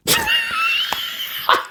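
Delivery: soft squeaky laugh, very high; a breathless high giggle; high wheezing laugh. Real, not acted. Laughing at the comment.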